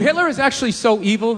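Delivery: ordinary delivery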